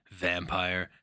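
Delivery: plain reading voice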